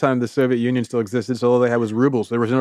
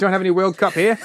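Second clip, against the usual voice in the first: higher, laughing mock cockney; cockney accent; 5 semitones higher than usual. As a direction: play innocent in cockney.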